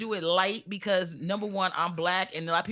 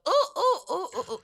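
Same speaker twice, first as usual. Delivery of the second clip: monkey hoots; 11 semitones higher than usual. Acted. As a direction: answer with high ape calls. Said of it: "And the monkey goes".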